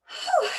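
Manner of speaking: high, breathy whew of relief; exhale whew. Real, not acted. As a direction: blow out hard after effort. Exhale after a hard set.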